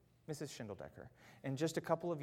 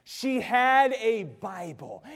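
loud, high, slow preaching; forceful repeat; 7 semitones higher, 11 dB louder, much slower than usual. Real, not acted. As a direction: drive each word home from the pulpit. Same reveal repeated with force.

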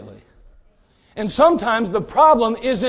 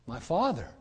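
soft plea; 5 semitones lower, 13 dB quieter than usual. Retold or imitated. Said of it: "Tries to reason with him".

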